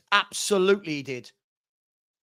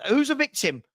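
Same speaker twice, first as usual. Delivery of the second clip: usual speaking voice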